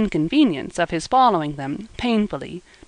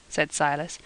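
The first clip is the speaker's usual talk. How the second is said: usual speaking voice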